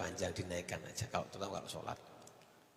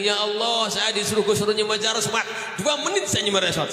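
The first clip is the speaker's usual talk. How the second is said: loud, high, excited retelling of his prayer; retold excited cry; 10 semitones higher, 8 dB louder than usual. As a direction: belt it out like the pilgrim did. Man retells his prayer at Mecca.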